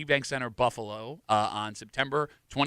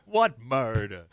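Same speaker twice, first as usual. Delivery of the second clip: slow Brooklyn "what murder"; Brooklyn accent; 3 semitones lower, much slower than usual. Pretend. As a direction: drawl it like a New Yorker. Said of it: "I did the what murder thing".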